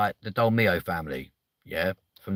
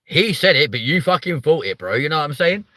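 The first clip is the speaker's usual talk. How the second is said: loud teasing; 6 semitones higher, 6 dB louder than usual. Real, not acted. Teasing chat loudly.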